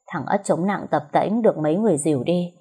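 usual speaking voice

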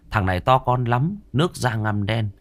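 plain reading voice

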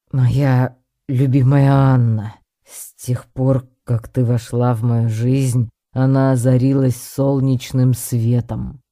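loud, slow, stilted declamation; recited by heart. A narrator's reading. Professor proposing to Anna, reciting pompously. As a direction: deliver it like a memorised grand speech. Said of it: "будто Вернер выучил текст наизусть".

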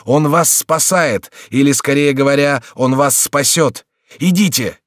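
high, urgent call; shouting. A narrator's reading. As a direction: shout reassurance, climbing to a command. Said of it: "кричал Реми".

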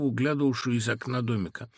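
ordinary delivery

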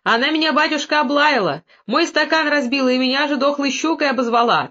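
high, aggrieved accusation; wailing complaint. A narrator's reading. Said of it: "тыкала Мелодора пальцем на Дашутку".

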